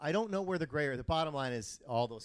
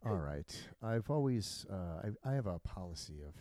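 slow pilot voice; 5 semitones lower than usual. Retold or imitated. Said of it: "and then he goes".

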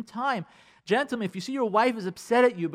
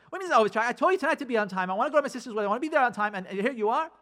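higher, louder indignant complaint; escalating wife; 6 semitones higher, 3 dB louder, much faster than usual. Retold or imitated. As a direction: act a wife building to a fight. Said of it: "It becomes a fight".

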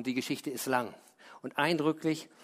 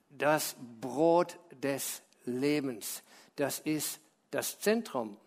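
slow emphasis; 4 semitones lower, 3 dB quieter, much slower than usual. Acted. Emphasizing the center.

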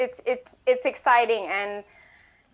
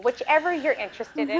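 ordinary talk, nothing marked

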